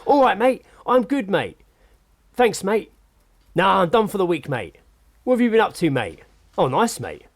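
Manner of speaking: imitating a chatty bloke on his mobile, mate on every line; overheard bloke on the phone. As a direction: mimic a man phoning a friend, tacking mate onto everything. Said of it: "he's obviously listening to one side of a conversation on the phone".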